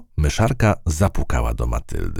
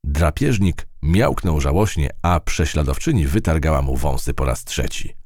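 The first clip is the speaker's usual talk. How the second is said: plain reading voice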